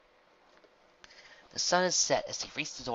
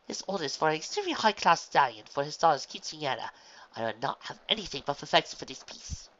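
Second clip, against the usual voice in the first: a raised proud voice; haughty designer; 4 semitones higher, 6 dB louder than usual. A narrator's reading. Fashion designer boasting of her high-class order, a touch louder. Rarity bragging about order.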